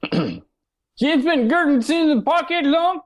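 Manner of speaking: put-on high, slow, strangled Pittsburgh accent; Pittsburgh accent pushed up into a choked squeak; mock Pittsburghese. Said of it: "let me see if I can do it actually in Pittsburghese".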